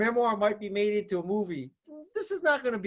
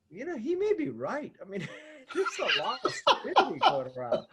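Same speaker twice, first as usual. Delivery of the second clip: laughing admission; 5 semitones higher than usual. Real, not acted. Laughs while admitting dad's point.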